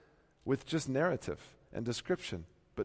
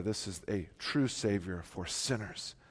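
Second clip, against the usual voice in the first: ordinary talk, nothing marked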